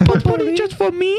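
high silly voice. Retold or imitated. Imitating Isaac's weird sayings.

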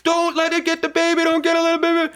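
very high-pitched imitated panic cry; mock frantic plea. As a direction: cry out in hammed-up alarm for the baby. Acted cries as gremlin grabs baby.

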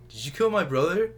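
high, loud bad-actor confrontation; mock accusation. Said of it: "when he's like".

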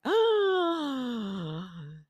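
long, high mock gasp; huge theatrical gasp; starstruck gasp. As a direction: re-enact a drawn-out gasp of recognition. Acting out recognizing the actress.

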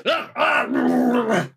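high-pitched acted "ah"; strained cry. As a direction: cry out as if being taken over. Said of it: "[name] just goes".

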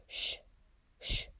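sharp shushing hiss; loud hiss. A narrator's reading. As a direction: hiss at a cat to shoo it. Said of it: "zischte Mister Dursley laut".

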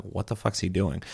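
soft, confused aside in a game; puzzled aside. Retold or imitated. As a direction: ask it quietly to yourself. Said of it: "I'm like".

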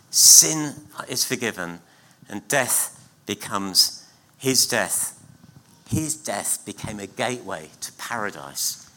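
usual speaking voice

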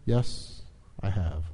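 low, soft, measured pronouncement; majestic computer. A narrator's reading. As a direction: answer with hushed majesty. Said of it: "interrupted Deep Thought majestically".

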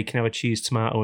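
normal talking voice

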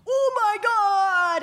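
high stretched oh my God; imitated excited outburst. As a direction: copy his booming excited cry. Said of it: "and then you just go".

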